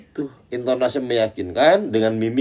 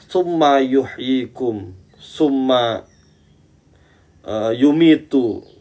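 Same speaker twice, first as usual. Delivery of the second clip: mock self-assured verse recital; 5 semitones higher, 6 dB louder, much slower than usual. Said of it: "Dalam Al-Quran pun ada".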